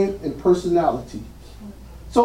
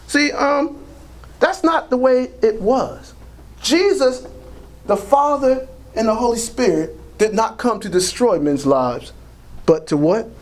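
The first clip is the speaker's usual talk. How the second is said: ordinary talk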